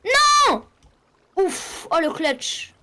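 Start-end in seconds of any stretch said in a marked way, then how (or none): 0.0-0.7 long "non" crié, très aigu; cri de refus. Real, not acted. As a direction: shout a high, drawn-out no. Cri en pleine partie. (crié très fort)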